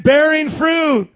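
loud, high, drawn-out rallying cry; excited. Shown as a demonstration. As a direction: call it out slowly with big excitement. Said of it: "say it like you're excited".

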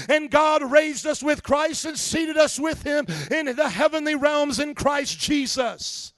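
loud, high, slow declaiming; full-force preaching. Real, not acted. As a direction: build and proclaim to the congregation.

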